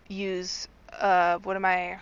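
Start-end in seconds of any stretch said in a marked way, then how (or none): none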